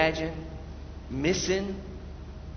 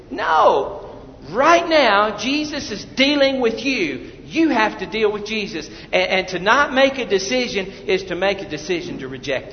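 full-force preaching; 3 semitones higher than usual. Real, not acted. Preacher demands the congregation deal with Jesus, full-force preaching. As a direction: demand a decision right now.

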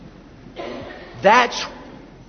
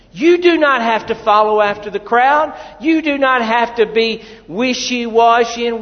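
ordinary talk

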